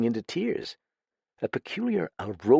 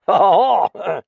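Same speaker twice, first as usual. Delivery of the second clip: loud, high boozy laugh; a sloshed chuckle at his own wit; loud tipsy ha ha ha; drunken laugh; 11 semitones higher, 9 dB louder, slower than usual. Acted.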